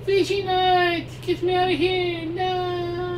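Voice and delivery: high, slow, strained cries of a captured woman; Gerudo lady voice. Acted. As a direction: shriek to be let go, drawn out. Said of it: "the Gerudo lady's voice".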